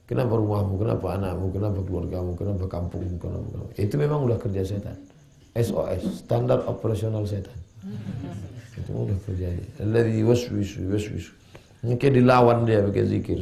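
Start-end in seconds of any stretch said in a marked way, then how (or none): none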